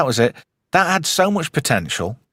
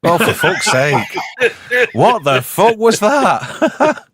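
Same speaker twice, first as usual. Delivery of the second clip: drawn-out, high, incredulous laugh-talk; exasperated, laughing outburst; laughing disbelief; 8 semitones higher, 3 dB louder, much slower than usual. Real, not acted. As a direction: laugh in exasperation at a terrible attempt. Mocks the failed accent attempt.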